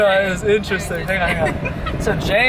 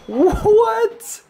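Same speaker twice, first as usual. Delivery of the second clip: high, stretched what of disbelief; astonished; 13 semitones higher than usual. Real, not acted. Finding a whole extra room, high astonished yelp. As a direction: let it leap up high in amazement.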